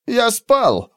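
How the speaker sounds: high indignant protest; huffy, offended retort. Acted. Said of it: "возмутился Драшер". (возмущённо, высоким голосом)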